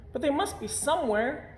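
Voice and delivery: put-on high, childlike bear voice, puzzled; a small bear who can't find his trousers; puzzled little bear. A narrator's reading.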